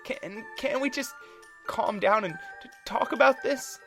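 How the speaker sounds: high, loud stammering plea; panicked plea. Acted. Thomas pleading over the fight, high and shaky. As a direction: beg them to stop, rattled. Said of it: "Thomas shouted nervously".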